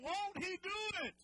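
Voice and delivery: very high, loud preacher shout; loud shout of faith; shouted with conviction. Shown as a demonstration. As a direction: belt it to the back row. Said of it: "say it again".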